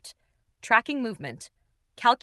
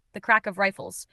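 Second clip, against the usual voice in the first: usual speaking voice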